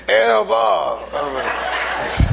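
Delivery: a hint of Charlie's drawn-out ever, mid-laugh; lightly voiced Charlie, cracking up; imitating Charlie. Preacher to congregation, quoting Charlie with a laugh. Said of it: "Or as Charlie say".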